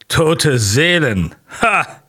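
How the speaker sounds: Nozdrev's brash, boastful outburst; laughing loudly. A narrator's reading. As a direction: bellow it out with a scornful laugh. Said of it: "lacht er laut".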